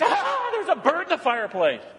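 high mock panic cry, laughing; retelling wife's panic at a bird, high and laughing; panicked wife. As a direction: squeal it like a startled wife. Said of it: "my wife panicked".

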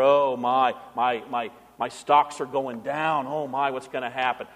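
low, subdued mock fretting; mock complaining. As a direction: imitate a worrier moaning over losses. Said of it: "Stop complaining".

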